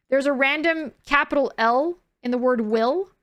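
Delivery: ordinary talk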